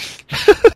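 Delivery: a sharp high breathy laugh; burst of laughter. Real, not acted. Cohost's fortune cookie joke, sharp laugh.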